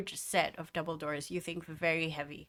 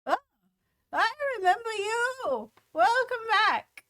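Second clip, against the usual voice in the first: put-on old woman voice; 7 dB louder than usual. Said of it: "the old woman ... goes".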